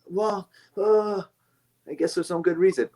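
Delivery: a hint of a timid excuse-maker; mock sheepish self. Scared self answering own pep talk, sheepish.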